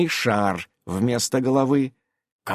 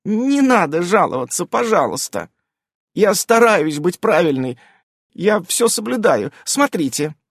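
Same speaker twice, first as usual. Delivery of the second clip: lightly voiced high fox girl, eager to please; a nervous werefox pleading; hint of a fawning witch; anxious fox witch; 6 semitones higher than usual. A narrator's reading.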